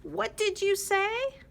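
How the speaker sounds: high, loud indignant crow; loud squawky crow voice; crow's challenge. Acted. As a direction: demand it like a cross crow. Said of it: "said Crow".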